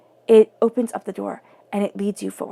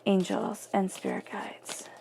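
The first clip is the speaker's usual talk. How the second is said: hushed card-reader's prayer; murmured invocation; 9 dB quieter than usual. Acted. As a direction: pray quietly to yourself, trailing softer. Murmured invocation over the cards.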